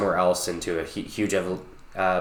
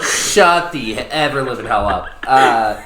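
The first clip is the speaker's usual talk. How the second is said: louder joking outrage; mock outraged; 4 semitones higher, 7 dB louder, slower than usual. Real, not acted. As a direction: blast it back in playful indignation. Friend teases about age.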